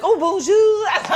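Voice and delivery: high, drawn-out mock-French greeting; hammed-up Parisian accent, laughing; fake Parisian accent. Boarding the plane, slipping into fake Parisian accent. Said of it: "slipping into my fake Parisian accent".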